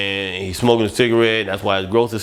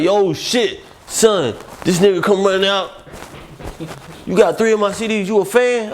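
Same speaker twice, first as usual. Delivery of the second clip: excited [name]; 9 semitones higher than usual. Retold or imitated.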